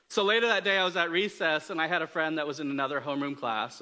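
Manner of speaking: ordinary talk, nothing marked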